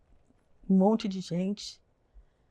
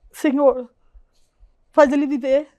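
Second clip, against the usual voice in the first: raised, louder retold plea to God; imploring, higher and louder; pleading prayer; 5 semitones higher, 6 dB louder, slower than usual. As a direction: beg God, voice lifted. Voicing her desperate plea to God.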